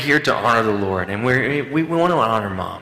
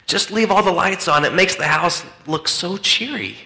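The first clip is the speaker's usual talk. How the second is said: high, sing-song mom voice, playfully mocking; mock cheery mom; 7 semitones higher than usual. Pastor joking to congregation, mocking carefree mom voice. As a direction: pitch it up like a breezy carefree mom.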